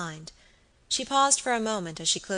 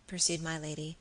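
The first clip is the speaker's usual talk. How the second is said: usual speaking voice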